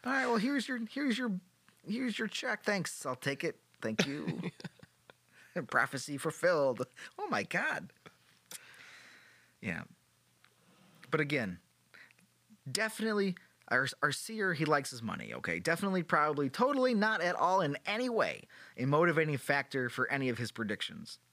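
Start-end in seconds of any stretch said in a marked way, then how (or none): 0.1-3.0 high, comic voice handing over payment; mock payoff. Host playing the queen paying the seer in a high comic voice. 15.6-21.2 sarcastic hedging. Acted. Sarcastic hedging about money.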